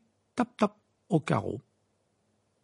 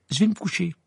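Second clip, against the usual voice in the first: higher, abrupt, irritated; curt reply; 7 semitones higher, 4 dB louder than usual. A narrator's reading. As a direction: close the discussion sharply. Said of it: "répliqua sèchement Harry". (répliqué sèchement)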